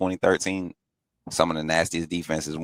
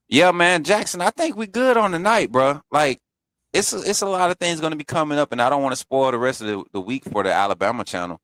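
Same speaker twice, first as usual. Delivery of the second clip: excited; 8 semitones higher, 3 dB louder than usual. Real, not acted. Wrapping up, pitched up.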